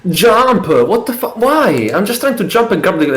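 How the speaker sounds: high frustrated shouts at the game; frustrated yelling. Real, not acted. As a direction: yell at the game when the jump fails. Failing a jump in a speedrun.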